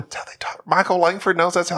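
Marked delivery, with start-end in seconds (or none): 0.0-0.6 hushed, unvoiced whisper; a breathy conspiratorial whisper. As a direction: whisper it like a secret aside. Whispered defence of the impression.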